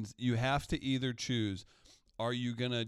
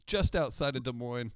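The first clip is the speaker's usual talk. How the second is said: mock prayer; 2 semitones higher, 4 dB louder than usual. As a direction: beg the Lord in a playful plea. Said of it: "I start praying".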